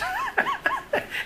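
a real high burst of laughter. Real, not acted. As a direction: laugh out at the negative scores. Laughing at bad evaluations.

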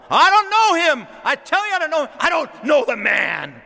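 high, loud, frantic denial, slowing at the end; panicked denial. Acted. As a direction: shout it out in terror of being caught. Peter denying Jesus, panicked.

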